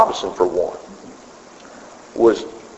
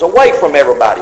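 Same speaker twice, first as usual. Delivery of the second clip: ordinary talk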